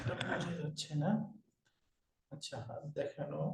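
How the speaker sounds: very soft, low murmur; trailing murmur while sorting something out; muttered aside. Real, not acted. Aside while fiddling with camera view.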